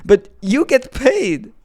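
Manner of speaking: slow, drawn-out fake revelation; mock shocking reveal. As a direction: deliver it like a scandalous secret.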